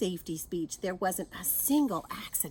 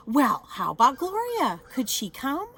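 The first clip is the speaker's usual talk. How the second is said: hopeful principal; 5 semitones higher, 8 dB louder than usual. A narrator's reading. Principal asks for Gloria.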